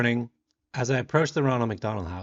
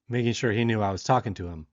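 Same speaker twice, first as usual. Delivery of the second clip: ordinary delivery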